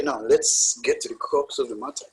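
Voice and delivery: ordinary talk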